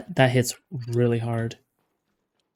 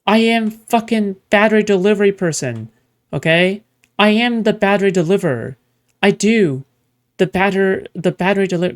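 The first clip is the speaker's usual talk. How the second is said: louder and higher, fed-up ranting; 5 semitones higher, 4 dB louder than usual. Real, not acted. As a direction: shout your frustration at the chores. Ranting about delivering batteries.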